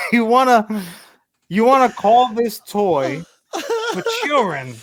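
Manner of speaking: high, slow, laughing incredulity; laughing at a ridiculous toy name; laughing disbelief. Real, not acted.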